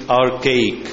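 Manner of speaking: "archaic" drawn out slowly, AR-kay-ik; slow pronunciation. Shown as a demonstration. Teacher repeats the dictionary word for students, slow and clear. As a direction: spell out "archaic" by sound, unhurried. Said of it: "كيف ننطق هذه الكلمة".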